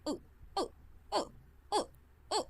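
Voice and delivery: short "ओ" forced through clenched teeth; separate, repeated "ओ" with teeth stuck together. Shown as a demonstration. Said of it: "उसी तरीके से होगा". (दांत चिपकाकर छोटा "ओ")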